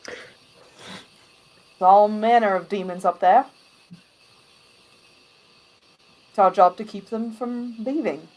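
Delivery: loud, firm gate guard; stern guard. Acted. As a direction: bar the way like a soldier. Guard warns about demons.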